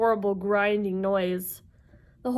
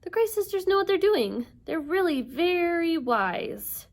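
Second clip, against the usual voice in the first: high-pitched, anxious reassurance; worried; 9 semitones higher than usual. Acted. Annabeth reassuring her friends in the taxi, worried. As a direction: insist it's fine, pitched up with worry. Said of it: "sounding pretty worried".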